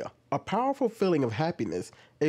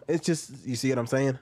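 ordinary talk, nothing marked

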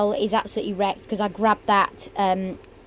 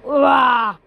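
frustrated yell; 5 semitones higher, 10 dB louder than usual. Real, not acted. Venting after long frustration.

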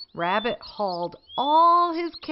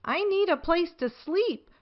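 Rabbit voiced a touch higher; light, higher voice for Rabbit; 7 semitones higher than usual. A narrator's reading. Rabbit voiced in read-aloud story.

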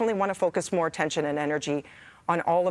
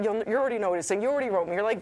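normal talking voice

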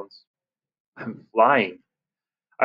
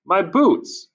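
surprised; 8 semitones higher than usual. A narrator's reading. Spots her lost boots.

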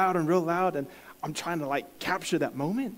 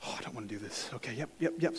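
much softer, reluctant self-talk; nervous mutter; 5 semitones lower, 12 dB quieter, faster than usual. Retold or imitated. Talking himself into it, softer nervous mutter. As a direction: psych yourself up under your breath. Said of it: "So I'm like".

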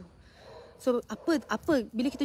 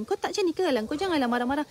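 higher, louder mock scolding, telling her to calm down; blaming scold; 5 semitones higher, 5 dB louder than usual. Speaker imitates a blamer scolding a stressed carer, higher and louder. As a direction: wag a finger and lecture her to relax. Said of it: "jangan kita blame".

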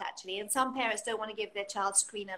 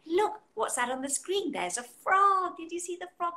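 put-on reading to a toddler; 7 semitones higher, 4 dB louder than usual. Shown as a demonstration. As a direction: point out the frog on screen to a baby. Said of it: "and you're saying".

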